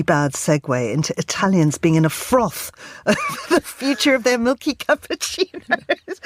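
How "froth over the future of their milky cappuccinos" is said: giggling through the words, pitch jumping high; laughing. Real, not acted. Host laughing at her own bad segue. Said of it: "I'm so sorry".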